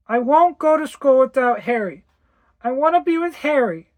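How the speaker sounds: put-on high, loud child's wail; a little girl crying for her friend; tearful child pleading. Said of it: "She began to weep loudly".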